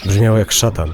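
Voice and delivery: ordinary delivery, nothing marked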